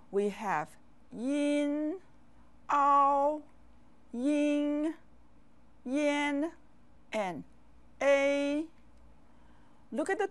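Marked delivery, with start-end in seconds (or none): 1.1-6.5 "yin, ao, ying, yan" each held on a high level note; pinyin finals demo. Shown as a demonstration. Teacher modelling compound finals for learners, "yin, ao, ying, yan" high and sustained. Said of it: "How about compound finals". 8.0-8.6 "a" held on a high, level, louder note; pinyin final demo. Shown as a demonstration. Teacher modelling a compound final for learners, "a" high and sustained. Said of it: "How about compound finals".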